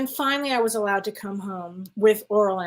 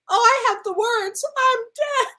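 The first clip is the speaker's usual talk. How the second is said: much higher, hammed-up protest; mock despair; 13 semitones higher, 3 dB louder than usual. Crying patient answering the nun, comically despairing. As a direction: exclaim it high, in comic despair.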